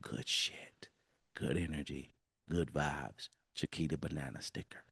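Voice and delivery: hushed, soothing inner whisper; soft whisper. Shown as a demonstration. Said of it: "constantly whispering".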